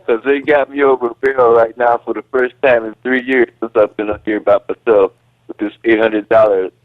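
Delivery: usual speaking voice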